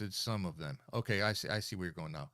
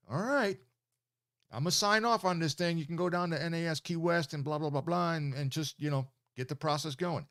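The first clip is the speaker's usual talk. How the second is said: lightly mimicked official, pitched up; higher voice, brushing through the formalities; governor giving in; 6 semitones higher, 4 dB louder than usual. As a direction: imitate an old governor granting approval. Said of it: "and he says".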